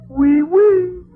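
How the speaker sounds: high, loud, squealing "Oui, oui"; excited squeal. Real, not acted. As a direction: squeal it out as she squeezes you. Squeezed in Mimi's hug.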